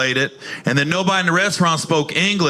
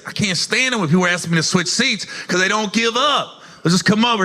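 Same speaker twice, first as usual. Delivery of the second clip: ordinary talk, nothing marked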